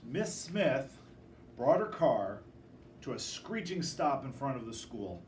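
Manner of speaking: ordinary delivery, nothing marked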